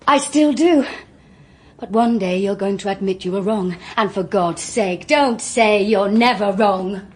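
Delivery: loud, wounded cry, slowing at the end; hurt outburst. Acted. Joyce lashing out at the barrister who thinks her guilty, loud and hurt. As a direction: fling it at him before storming out.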